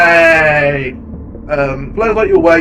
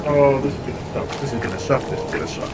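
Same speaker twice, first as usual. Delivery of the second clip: scared mutter, getting softer; dreading, fading quietly; 5 semitones lower, 8 dB quieter, faster than usual. Real, not acted. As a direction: dread it, fading to almost nothing. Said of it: "I am terrified of the ocean".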